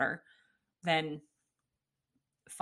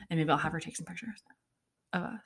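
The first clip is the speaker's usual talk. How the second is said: ordinary talk, nothing marked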